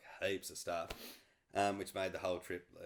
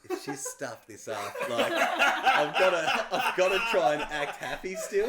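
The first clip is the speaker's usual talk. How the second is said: laughing inner thought; 10 semitones higher, 13 dB louder, slower than usual. Retold or imitated.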